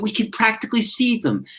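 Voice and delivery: high, light voice of little mice marvelling; small high mouse voices, awed; mice in wonder. Retold or imitated. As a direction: lift the voice up high like tiny mice amazed. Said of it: "and they said".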